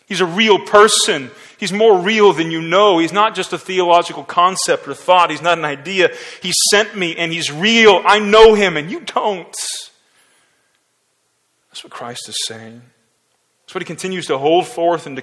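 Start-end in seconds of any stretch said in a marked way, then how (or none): 0.1-9.5 loud, high, building preaching; preaching at full force. Acted. Preacher paraphrasing Jesus to the crowd, full force. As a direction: drive every line home.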